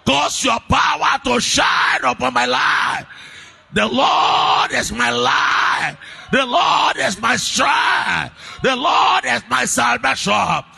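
loud, high fervent prayer over music; shouted prayer. Acted. Preacher praying over the church, a loud fervent prayer. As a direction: pray at full force, pleading.

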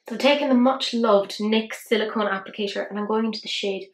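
ordinary talk

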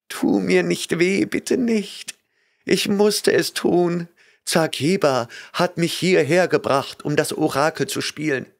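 put-on terrified young woman begging; tearful frightened girl. A narrator's reading. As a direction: beg him not to hurt you. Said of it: "Tränen liefen ihr über die Wangen".

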